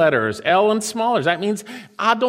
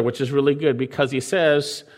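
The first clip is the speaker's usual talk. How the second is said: ordinary talk, nothing marked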